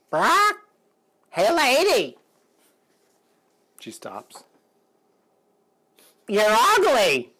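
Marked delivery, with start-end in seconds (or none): 0.1-2.2 high, loud parrot squawk. Retold or imitated. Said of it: "the bird catches her eye and says". 6.3-7.3 slow, high, loud parrot jeer; parrot insult. Retold or imitated. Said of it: "the bird catches her eye and says".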